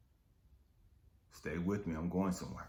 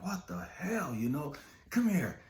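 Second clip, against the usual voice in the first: high, loud mock boss yell; impatient boss summoning the worker; boss calling him over; 11 semitones higher, 3 dB louder than usual. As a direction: bark it like an irritated boss.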